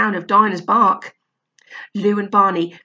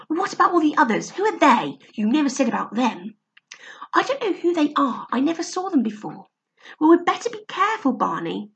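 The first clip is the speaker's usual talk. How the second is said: put-on a bit higher anxious child voices; lightly voiced children; 6 semitones higher than usual. Lou and Barney arguing up the tree, lightly voiced kids. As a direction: talk like worried kids.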